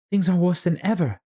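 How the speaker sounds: put-on high, girlish voice, a little worried; young girl fretting to herself; Alice voice. Young Alice fretting to herself, high and worried.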